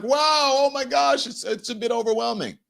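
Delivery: high-pitched mock astonishment; acted overwhelm. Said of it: "it can be overwhelming".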